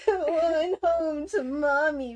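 mock taunt yelled through laughter; cracking up mid-taunt; loud giggling bully taunt; laughing yell. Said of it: "Arthas yelled".

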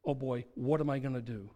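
low, subdued dismay; understated oh-no moment; mild acted shame. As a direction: let the voice sink in mild regret. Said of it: "And then I felt a sense of".

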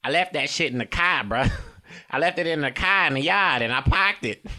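comic Boston caricature; Boston accent. Acted. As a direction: ham up Boston "kah", "yahd", "pahked".

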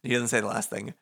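usual speaking voice

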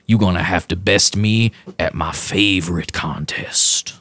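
lightly voiced looming strongman; a hint of a menacing giant; Danger Bob. GM as Bob leaning into the player, mildly menacing. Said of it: "leans into you now ... and goes".

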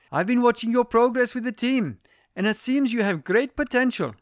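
put-on high, commanding Indian-accented boss. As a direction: praise the team lead in a put-on Indian accent. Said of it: "it gets across her commanding tone".

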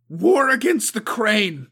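loud, high, harsh battle cry; harsh war cry. A narrator's reading. Suko rallies the clan, harsh loud war cry. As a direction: hammer the others with it. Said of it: "the harshness of her voice slamming".

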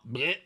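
short imitated vomit noise; mock retching. Man rushing into the stall, imitated vomiting. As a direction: retch out a quick fake heave. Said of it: "Just starts fucking vomiting".

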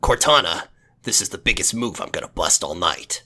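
low, quiet gruff soldier growl; Master Chief voice. Retold or imitated. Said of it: "He talks in a very deep, gravelly voice".

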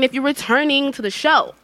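usual speaking voice

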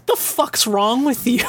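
squeaky incredulous retort; high, slow mock outrage. Retelling the hero's retort, high and incredulous. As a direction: quote him high and slow, baffled. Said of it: "he just goes".

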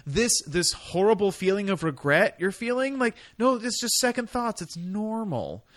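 high-pitched, pleading mock reassurance. Young lover dismissing her second thoughts, high mock reassurance. Voicing lover brushing off regrets.

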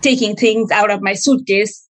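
ordinary talk

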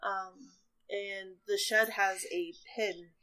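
usual speaking voice

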